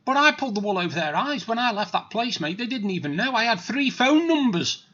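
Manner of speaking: ordinary talk, nothing marked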